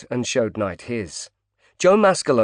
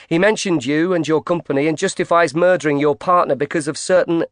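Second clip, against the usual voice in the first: pushy reporter; 6 semitones higher, 4 dB louder than usual. Acted. Reporter pushing in boldly.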